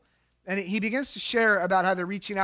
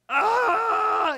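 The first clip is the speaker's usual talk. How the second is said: a higher, louder ah of alarm; mock yelp of alarm; 13 semitones higher, 5 dB louder than usual. Pastor reacting to the clam chowder, mock yelp. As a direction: yelp as if stung.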